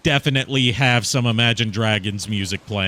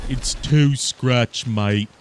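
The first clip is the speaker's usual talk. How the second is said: put-on slow, dim-witted British accent, drawn out; a lightly put-on dopey British accent; simpleton British man; much slower than usual. Streamer mocking his own British bit, dopey British accent. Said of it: "like a simpleton British man".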